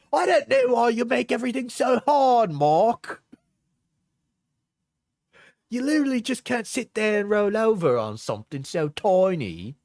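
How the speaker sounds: high exasperated parody, partly shouted; mocking high girly voice. Reads her messages in mock voice.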